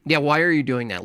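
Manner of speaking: ordinary talk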